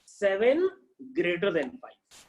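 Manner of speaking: slow, deliberate reading; careful teacher dictation; slow reading aloud. Shown as a demonstration. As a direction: drag the words out for the class. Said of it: "इसको क्या कहेंगे".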